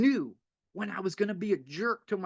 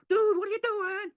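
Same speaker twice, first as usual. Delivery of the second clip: much higher, shaking someone awake; urgent wake-up call; 12 semitones higher than usual. Retold or imitated. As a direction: grab him by the shirt and snap him out of it. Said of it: "someone grab me by the shirt and go".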